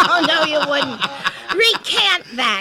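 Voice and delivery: loud laughing mock order. Real, not acted. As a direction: laugh and playfully demand it. Teasing a partner.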